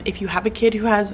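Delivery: ordinary talk, nothing marked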